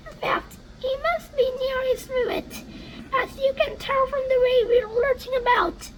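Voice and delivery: high, kind lady voice; ladybird voice. Acted. Ladybird offering to shelter James, high voice. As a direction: offer her wing gently, high.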